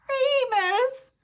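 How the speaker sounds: high squeaky cry of the name; squeak. Acted. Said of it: "Pettigrew squeaked".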